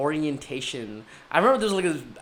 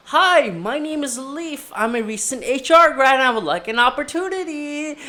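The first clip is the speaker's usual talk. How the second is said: overeager job pitch; 9 semitones higher, 7 dB louder than usual. Retold or imitated. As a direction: sell yourself a bit too hard. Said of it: "I'm like".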